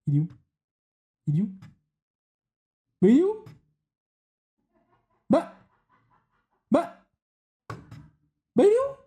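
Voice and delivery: startled by a sudden jump scare; scared horror game streamer. Real, not acted. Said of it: "il m'a fait peur".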